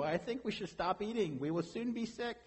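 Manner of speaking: normal talking voice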